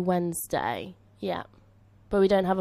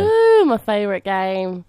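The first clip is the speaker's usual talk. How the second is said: drawn-out, louder delight; excited; 7 dB louder, slower than usual. Real, not acted. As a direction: gush over your favourite game. Excited about quiz.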